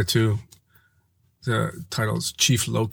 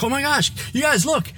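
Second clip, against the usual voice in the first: excited tease. Retold or imitated. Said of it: "I was like".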